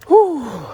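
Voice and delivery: high, loud blown-out exhale; a big relieved puff of breath; exhausted whew. Real, not acted. Reaching top of climb.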